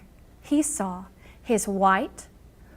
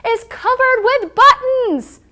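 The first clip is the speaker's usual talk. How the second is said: much higher, louder, slow scream; 12 semitones higher, 10 dB louder than usual. A narrator's reading. Angry Toad jumping up and down, screaming. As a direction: scream it in a rage. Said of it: "and screamed".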